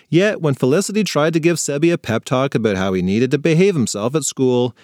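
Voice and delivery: plain reading voice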